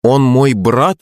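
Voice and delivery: high-pitched indignant question; indignant boy. A narrator's reading. Son reacting to news of a brother, indignant. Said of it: "возмущённо засопел их сын".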